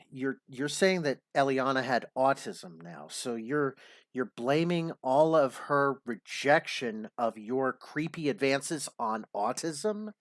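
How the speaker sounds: usual speaking voice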